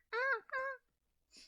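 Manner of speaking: very high put-on noises of brushing off; mock disregard voice. Passer-by ignoring an eager dog, mock disregard. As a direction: dismiss it in a tiny, squeaky-high voice. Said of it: "I don't know why I'm doing that voice for the disregard voice".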